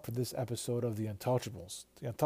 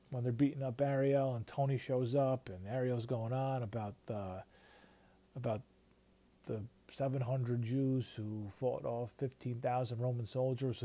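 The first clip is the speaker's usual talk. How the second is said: ordinary talk, nothing marked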